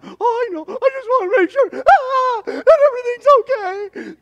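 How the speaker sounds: high, loud, teary imitation of an anxious mother; worried mom. Son imitating his worried churchgoing mom, high and teary. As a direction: mimic mom fretting, voice cracking toward tears. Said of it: "she's all worried".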